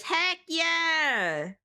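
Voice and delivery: high, bright cheer; excited cheer. Real, not acted. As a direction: cheer the win. Cheering streamer's boss win.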